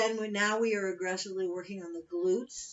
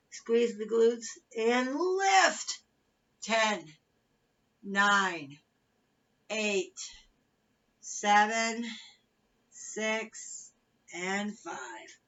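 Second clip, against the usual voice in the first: aerobics instructor; 3 semitones higher, 6 dB louder, much slower than usual. Acted. Fitness cueing and countdown.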